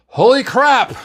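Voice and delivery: high, loud exclamation; shocked yell. A narrator's reading. Doctor finding blood on the floor, shocked yell. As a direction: yell out in alarm. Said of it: "McCoy yelled".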